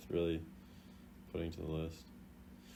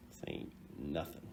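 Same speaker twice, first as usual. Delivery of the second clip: whispered echo; 6 semitones lower, 12 dB quieter than usual. Pretend. Repeating under breath.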